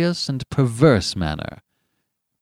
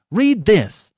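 high, loud, urgent demand; desperate cry; 11 semitones higher, 6 dB louder than usual. A narrator's reading. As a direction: insist desperately, voice raised. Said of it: "cried Benjamin desperately".

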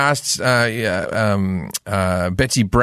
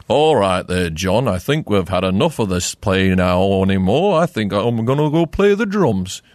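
put-on old Liverpool accent; 3 semitones lower than usual. Shown as a demonstration. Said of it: "the accent used to be like".

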